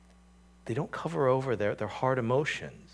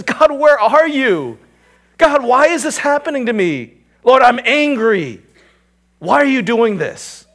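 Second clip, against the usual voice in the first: psalmist's anguished cry; 11 semitones higher, 12 dB louder than usual. Acted. Preacher voicing the psalmist crying out to God, loud anguished pleading. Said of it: "He says".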